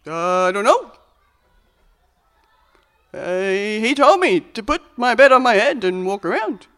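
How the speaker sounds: loud, high, bewildered answer; dopey healed man. Acted. Healed man answering the authorities, confused. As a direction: play it dim and innocent. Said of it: "the religious authorities see him".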